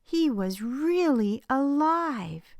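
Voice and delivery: joyful reveal. A narrator's reading.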